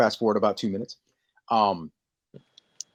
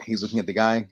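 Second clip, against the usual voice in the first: ordinary delivery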